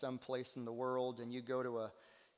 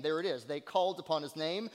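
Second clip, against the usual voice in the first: usual speaking voice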